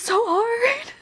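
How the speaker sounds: high, loud, slow wailing whine; a mock-dramatic wail about how hard it is; exaggerated whine. Real, not acted. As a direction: stretch it out as a hammed-up moan. Venting about rejection, exaggerated wail.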